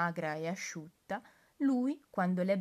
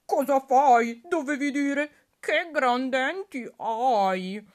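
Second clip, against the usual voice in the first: loud, higher indignant outburst; protesting wolf; 7 semitones higher, 12 dB louder, slower than usual. Acted. Wolf protesting the girl's wrong line, loud and indignant. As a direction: burst out in offended protest. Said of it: "protesta il lupo".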